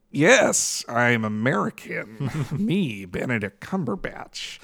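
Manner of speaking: slow, hushed British attempt at an American accent; Benedict Cumberbatch's American accent. Host mocks a British actor playing American, slow fake American accent. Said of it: "I also think of Benedict Cumberbatch's accent".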